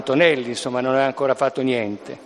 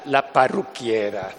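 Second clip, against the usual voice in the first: ordinary talk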